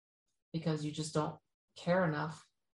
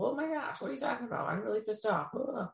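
high, indignant mock outrage; 6 semitones higher than usual. Insulted at work, mock outraged and high. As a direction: ham up being offended, pitched high. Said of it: "feathers would go up and be ruffled".

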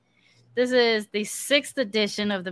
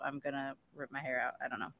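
ordinary delivery

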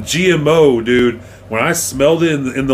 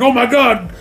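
high mock panicked cry; panicked call for help; 11 semitones higher than usual. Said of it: "It's like".

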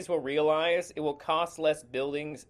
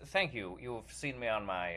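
ordinary delivery

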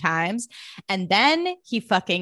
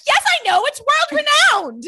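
much higher, louder mock-snooty Blair; 6 dB louder than usual. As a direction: squeak it out as a prissy know-it-all Blair. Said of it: "And Blair's like".

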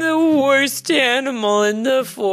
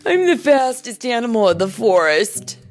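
loud, cocky coyote boast; boastful and punchy; bragging; 4 semitones higher, 6 dB louder than usual. A narrator's reading. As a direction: show off to everyone, raised pitch. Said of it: "Coyote bragged".

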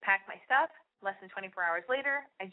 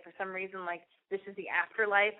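ordinary delivery, nothing marked

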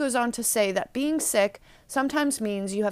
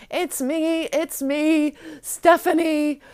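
mock breakdown, higher-pitched confession; Stephanie; 6 semitones higher, 4 dB louder, slower than usual. Girl reveals her true identity to uncle, mock breakdown. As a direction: give in and confess, high and building louder. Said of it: "she finally breaks down and reveals her true identity".

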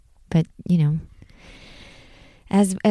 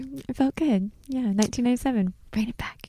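whispering; 4 semitones higher, 7 dB quieter, faster than usual. Real, not acted. Nostalgic aside, whispered.

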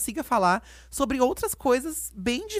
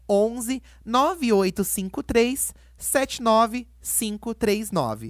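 slow, digit by digit; reading digits one by one; much slower than usual. Shown as a demonstration. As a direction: spell out the number slowly. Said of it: "Posso passar o número?".

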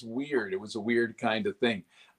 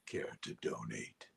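low, soft, slow unvoiced; accentless whisper; 12 semitones lower, 15 dB quieter, slower than usual. Shown as a demonstration. Actor showing Sheogorath dropping his accent. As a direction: drop the accent to a sly hush. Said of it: "drops the accent altogether".

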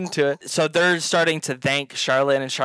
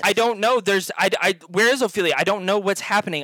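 higher, stammered repeated denials; flustered panic; 5 semitones higher, faster than usual. Acted. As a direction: babble helplessly under questioning. Panicked denial under interrogation.

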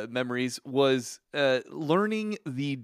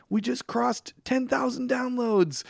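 high, slow mock-thrilled announcement; excited news; 11 semitones higher, slower than usual. Danny texting the host morning numbers, imitated with excitement. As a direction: announce a milestone, pitched way up. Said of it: "it was so exciting when Danny would".

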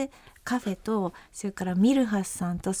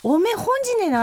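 higher, louder Akita dialect demo; 12 semitones higher, 5 dB louder than usual. Shown as a demonstration. Woman demonstrating a local phrase to co-host, Akita dialect. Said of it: "秋田弁でもね".

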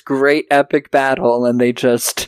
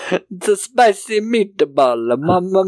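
slow, raised cartoon Italian accent; bad Italian accent; 4 semitones higher, slower than usual. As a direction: lay on a cliché Italian accent slowly. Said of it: "That's a terrible Italian accent".

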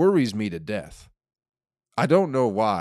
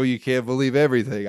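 a loud, preachy take on the saying with stress on "everything"; mock know-it-all; 2 semitones higher, 5 dB louder than usual. Comic scoffing back at people who say it, with a loud, smug stress on "everything". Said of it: "People go".

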